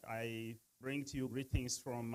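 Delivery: usual speaking voice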